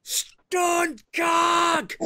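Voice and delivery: high yelled call, repeated; hammed-up high holler; mock crew shouting. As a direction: shout it out like a crew summoning someone. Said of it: "it's just people going".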